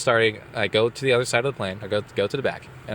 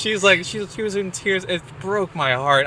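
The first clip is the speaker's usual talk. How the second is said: laughing, moved; 9 semitones higher than usual. Real, not acted. Still laughing, emotional.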